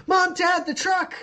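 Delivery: high, loud cry for parents; acted high-pitched alarm; child calling out. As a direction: yell like an alarmed kid. Girl calls to her parents.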